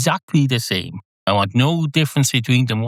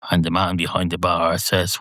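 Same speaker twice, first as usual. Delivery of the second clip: ordinary delivery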